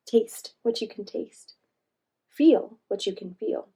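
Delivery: ordinary delivery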